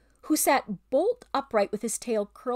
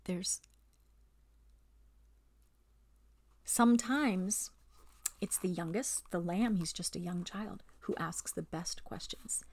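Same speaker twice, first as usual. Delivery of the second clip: much softer, trailing off; quiet aside; 2 semitones lower, 6 dB quieter than usual. Real, not acted. Reader steps aside to the listeners, softly. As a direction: confide it gently. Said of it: "aside to listener, softer".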